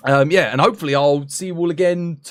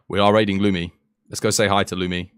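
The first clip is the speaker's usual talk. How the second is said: ordinary talk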